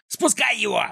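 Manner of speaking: high-pitched villain yell; shrieked, urgent order. A narrator's reading. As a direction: holler the command to release the dog. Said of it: "заорал Клопс".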